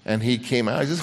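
usual speaking voice

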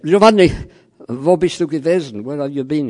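high, loud exasperated German editor; scolding boss. Retold or imitated. German editor scolding late colleague, loud and high. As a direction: demand to know where he was.

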